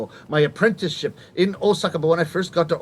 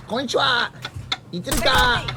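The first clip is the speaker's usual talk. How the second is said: neighbours trading lines in Kamigata Rakugo; Rakugo characters in Osaka dialect; 3 semitones lower than usual. Acted. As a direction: play the old man scolding the loafer. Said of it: "I did my story".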